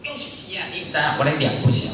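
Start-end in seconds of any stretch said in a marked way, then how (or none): none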